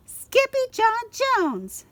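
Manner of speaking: high, loud, slow exclamation; family calling him out together; crying out. Acted. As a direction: shout his full name in shock. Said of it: "everyone cried".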